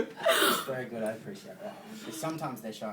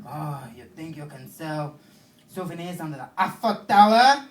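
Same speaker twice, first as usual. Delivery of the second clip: hammed-up French accent, getting much louder; mock French accent; 3 semitones higher, 14 dB louder than usual. Little French guy confronting a suspect, hammed-up French accent. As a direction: do a comic, blustering French accent. Mocking a French policeman's arrival.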